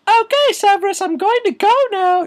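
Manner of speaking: very high, loud put-on woman's voice; falsetto professor. Professor taking leave of Severus, shrill falsetto. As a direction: chirp it in hammed-up falsetto.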